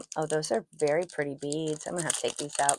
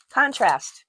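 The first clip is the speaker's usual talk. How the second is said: eureka shout; 8 semitones higher, 9 dB louder than usual. Real, not acted. Finally finds the missing word.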